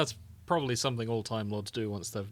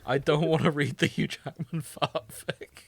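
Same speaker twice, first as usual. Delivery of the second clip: laughing; 3 semitones higher, 4 dB louder, slower than usual. Real, not acted. Laughing about Hugh Jackman fanfic.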